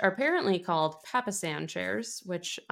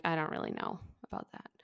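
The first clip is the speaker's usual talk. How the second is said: a retold, half-whispered shrug; hushed doubt; 2 semitones lower, 8 dB quieter, faster than usual. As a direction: let it fall away into a breath. Girl doubting boy's hopeful words.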